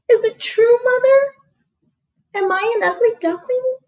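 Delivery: a hurt chick begging to be told it isn't true; hurt little duckling. Acted. Hurt duckling asks mother.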